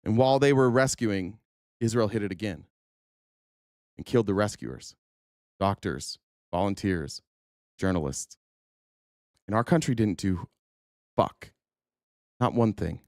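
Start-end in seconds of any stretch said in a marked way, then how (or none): 1.8-12.7 choked up. Real, not acted. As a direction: voice sinking, grief held in. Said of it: "this gets me a little choked up".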